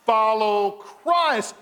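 slow emphatic answer. Shown as a demonstration. Preacher giving congregation the answer, slow and emphatic. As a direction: Follow Christ drawn out very slowly. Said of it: "as they what?".

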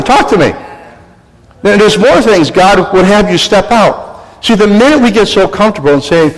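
ordinary talk, nothing marked

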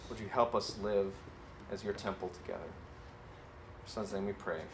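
gentle pastoral prayer trailing off; closing prayer. Acted. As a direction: pray quietly for the congregation.

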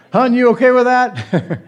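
high, loud sheepish question to his wife; mock husband asking. Husband asking his wife about cancelling insurance, sheepish. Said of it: "I'm gonna cancel all my life insurance policies".

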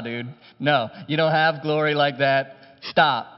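ordinary delivery

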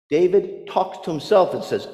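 ordinary talk

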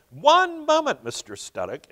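a sudden high-pitched one moment; interrupting. A narrator's reading. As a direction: jump in high to cut him off. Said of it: "interrupted the deputy director".

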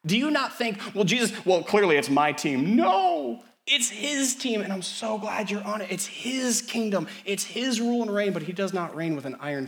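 high, loud ringing declaration; impassioned preaching. Acted. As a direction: proclaim it triumphantly. Preaching at full force.

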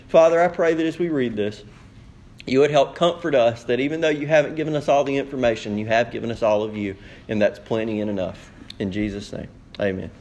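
low, subdued pastoral prayer. Real, not acted. Leads the congregation in prayer.

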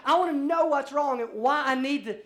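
indignant patient raising voice; mock indignant demand. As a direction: demand an answer from the doctor.

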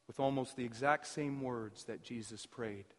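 ordinary delivery

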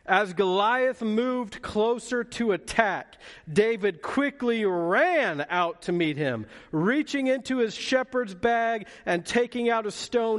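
high, ringing pulpit reading; dramatic scripture reading. Acted. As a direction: read the battle verse out with rising drama and force. Reads the scripture aloud.

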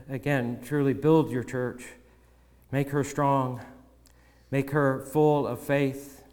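soft, earnest prayer voice that fades toward the end; closing prayer. Acted. Pastor praying for his church at the close, softly. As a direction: pray over the congregation, quiet and humble.

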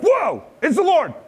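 high, astonished gasp of wonder; amazed whoa. Pretend. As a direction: squeal it out in amazement. Imagined reaction to a call.

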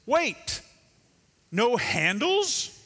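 "Wait" jumps high, then a slow "no handles?"; indignant pot. Pretend. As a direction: play a pot, mildly scandalized at its maker. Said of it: "the pot looks up to the potter and says".

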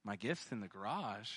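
low, soft puzzled echo; muted, retold bewilderment; puzzled thinking. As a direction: repeat it quietly to yourself, not getting it.

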